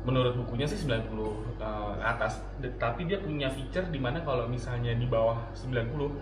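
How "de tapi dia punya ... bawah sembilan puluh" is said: normal talking voice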